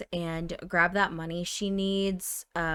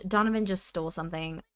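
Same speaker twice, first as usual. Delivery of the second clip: ordinary talk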